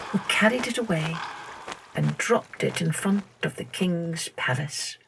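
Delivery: plain reading voice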